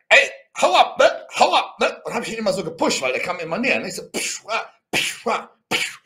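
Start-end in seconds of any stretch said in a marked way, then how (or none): 0.1-2.0 loud, raised-pitch retold command; re-enacted shouts in a scuffle; warning off. Acts out pushing the man away. 4.1-6.0 voiceless whooshing shove noises; mimed pushes, short bursts of air; imitated shoving. Imitates the repeated pushing.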